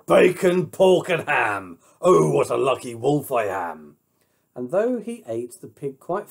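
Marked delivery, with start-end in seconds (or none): 0.0-4.0 loud, greedy wolf exulting; gleeful wolf shout. Acted. Wolf crows over his catch, loud and gleeful. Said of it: "He shouted".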